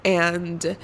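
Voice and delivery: ordinary delivery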